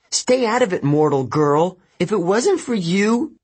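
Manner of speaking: high-pitched, upset lashing out; angry, near breaking. A narrator's reading. Annabeth turns on Rachel, angry and near breaking. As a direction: turn on them, pitch climbing toward breaking. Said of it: "her voice broke".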